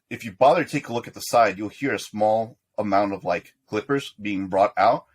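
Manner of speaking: ordinary talk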